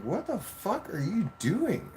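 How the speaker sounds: high-pitched, drawn-out incredulous demand; exasperated retold scolding. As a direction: stretch it out in high disbelief. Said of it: "I had to stop her".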